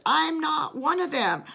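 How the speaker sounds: high, heated insistence; irate denial. Retold or imitated. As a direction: deny him in anger. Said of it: "He gets irate and angry".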